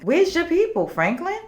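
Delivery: high-pitched disbelief at Franklin; incredulous question. Retold or imitated. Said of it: "I'm like".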